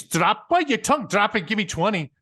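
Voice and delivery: loud, high barked order, mock Scottish sergeant; hammed-up drill sergeant. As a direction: bark it like a staff sergeant. Sergeant orders push-ups.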